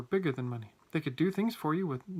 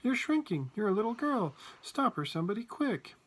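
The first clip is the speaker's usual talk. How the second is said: high-pitched urgent plea; panicky, lifted voice; alarmed shouting; 7 semitones higher than usual. A narrator's reading. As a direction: beg someone to step in. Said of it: "shouted Grandpa Joe".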